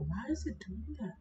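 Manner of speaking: breathy puzzled whisper; hushed irritated whisper at the laptop. Real, not acted. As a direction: whisper it to yourself, baffled. Fan noise, puzzled aside.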